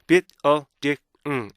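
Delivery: BUILDING chopped into bi-l-di-ng, each beat separate; "building" in four detached beats, ending on a hummed ng; four micro-syllables. Shown as a demonstration. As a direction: punch out "building" piece by piece with stops between. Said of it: "네 개 미세 음절을 읽어주셔야".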